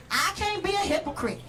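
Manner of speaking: ordinary talk, nothing marked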